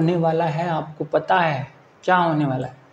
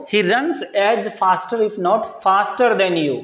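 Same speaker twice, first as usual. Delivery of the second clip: whole sentence read out very slowly; slow dictation; much slower than usual. Shown as a demonstration. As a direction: dictate it word by word to students. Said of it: "reads the full model sentence".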